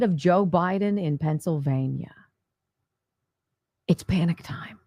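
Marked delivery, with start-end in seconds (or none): 3.9-4.8 hushed, breathy stage whisper. Acted. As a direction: whisper it like a secret. Whispered after poll list.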